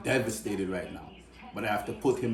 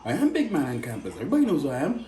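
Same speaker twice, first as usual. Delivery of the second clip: cocky younger self; 12 semitones higher than usual. Retold or imitated. To his friend, mocking his teen self with high cocky swagger. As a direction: puff up like the big man on campus, laughing.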